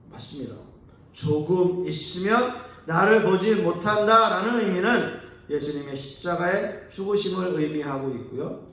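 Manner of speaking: usual speaking voice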